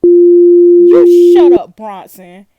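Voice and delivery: very loud, drawn-out yell; outraged shout. Real, not acted. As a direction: shout over him, stretching it out. Angry retort at game's detective caller.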